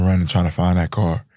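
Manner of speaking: normal talking voice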